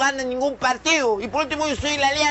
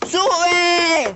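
boarding call; 4 semitones higher than usual. Shown as a demonstration. Trainee repeats the boarding call.

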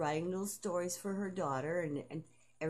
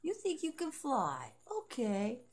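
high and slow, settling down on the end; a high, slow taunt that falls; slow mocking taunt; 6 semitones higher, slower than usual. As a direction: drag it out smugly. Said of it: "she goes".

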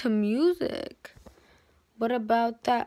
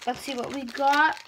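normal talking voice

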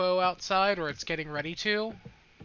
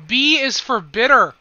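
loud taunt; 4 semitones higher, 12 dB louder than usual. Real, not acted.

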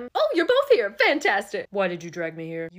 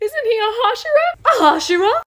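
high, loud panicked squeal; shrill alarm from a startled demon; alarmed shriek; 13 semitones higher, 12 dB louder than usual. Acted. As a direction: panic at the word Hashira.

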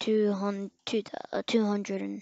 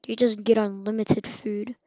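usual speaking voice

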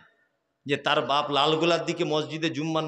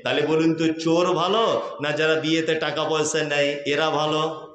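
ordinary talk

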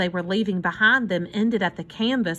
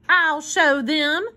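slow, raised-pitch defiance; defiant resolve; 6 semitones higher, 3 dB louder, much slower than usual. A narrator's reading. Hobo vows to reclaim his bridge, defiantly. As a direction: draw it out as a threat.

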